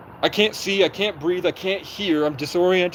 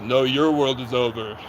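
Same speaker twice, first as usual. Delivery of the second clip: slow, lower, lightly voiced cop threat; a hint of a menacing officer; mock officer; 3 semitones lower than usual. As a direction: drag out a smug cop's comeback. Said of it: "And he goes".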